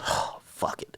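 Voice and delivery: oh, fuck it in a whisper; whispered decision. Retold or imitated. Deciding to do the interview, whispered. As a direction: breathe it out, giving in.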